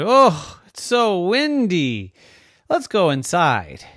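mildly dramatic grumble, starting high and loud; townsfolk complaint. Acted. Townsfolk fleeing a windy day, complaining. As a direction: complain about the weather, easing off at the end.

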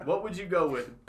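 ordinary talk

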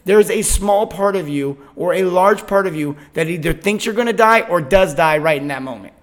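normal talking voice

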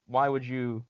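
usual speaking voice

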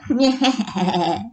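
rising evil cackle; witch cackle. A narrator's reading. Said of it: "wasn't that fantastic cackling".

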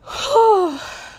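high, loud, heavy exhale; emotional sigh. Real, not acted. Speaker after admitting dark thoughts about living, emotional sigh. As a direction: let out a real, overwhelmed breath. Overwhelmed after confessing not wanting to live.

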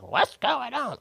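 high-pitched imitated challenge; imitating a wary "what's going on"; mock challenge. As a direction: pitch it up like someone confronting strangers. Imitates suspicious people reacting.

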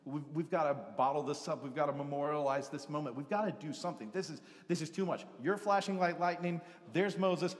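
a man babbling in awe and fear; panicked excitement. Retold or imitated. Peter babbling to Jesus on the mountain, frantic. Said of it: "So he began to".

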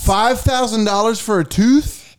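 slow, high, disbelieving question; surprised. Real, not acted. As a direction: stretch it out in mock shock. Shocked at the kid's demand.

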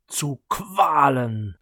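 loud and slow anguished cry. A narrator's reading. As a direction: drag out the words in torment. Undead brother cries out.

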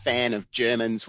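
ordinary delivery

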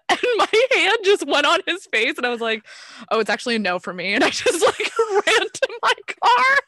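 loud, high, laughing throughout; real giddy laughter; laughing while telling. Real, not acted.

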